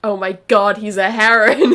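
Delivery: loud, delighted outburst; excited shout. Real, not acted. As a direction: shout it in amazed glee. Shouted reaction to learning Leren's a heron.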